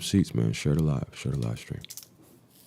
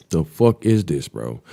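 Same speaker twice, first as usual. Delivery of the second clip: ordinary delivery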